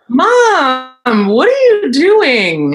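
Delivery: put-on high, loud kid voice; the kids asking their mom, high and loud; voicing the kids. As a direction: play the puzzled kids.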